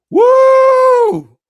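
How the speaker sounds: huge high whoop of delight; excited whoop. Real, not acted. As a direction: let out a wild cheer. Cheering at a scene.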